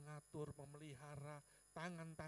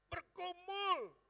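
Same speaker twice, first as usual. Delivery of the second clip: BERGUMUL shouted high and very slowly; drawn-out anguished shout; 12 semitones higher, 11 dB louder, much slower than usual. Retold or imitated. Preacher recalling his struggle with God, long anguished shout. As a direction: stretch out BERGUMUL in a loud, high cry.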